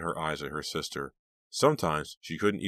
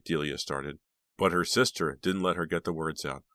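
plain reading voice

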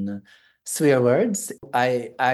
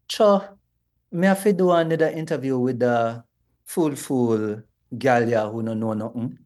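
Jamaican patois. Shown as a demonstration. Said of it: "an interpretation of a line?".

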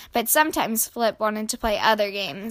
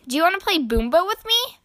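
put-on high, fast, rising childlike question; quick high-pitched kid voice; eager invitation; 7 semitones higher, much faster than usual. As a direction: ask in a hopeful rush. Eager invitation to new friend.

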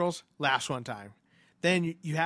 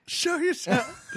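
much higher, slow, drawn-out taunt; echoing the ghost hunter parody, slow mock taunt; 4 dB louder, much slower than usual. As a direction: stretch it out mockingly.

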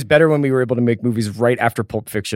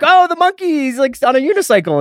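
high, loud mock complaint; mock indignant; 5 dB louder than usual. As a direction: play the offended performer, pitched up. Mocking how people treat him.

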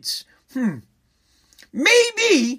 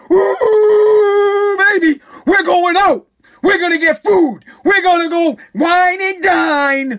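high, loud, whooping mock glee; hammed-up squealing celebration; giddy parents; 6 semitones higher, 5 dB louder, slower than usual. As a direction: crow like parents who just got paid. Parents blowing the stimulus cash.

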